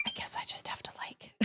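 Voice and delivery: soft whisper. Real, not acted. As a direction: whisper close to the mic. Streamer whispers throughout.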